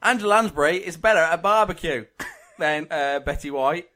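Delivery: hammed-up Aussie accent, tailing off; overdone Australian twang; bad Australian accent. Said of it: "I can't do Australian".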